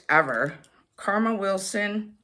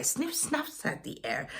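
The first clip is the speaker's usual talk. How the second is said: plain reading voice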